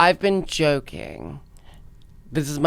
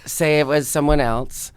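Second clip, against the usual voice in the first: ordinary talk, nothing marked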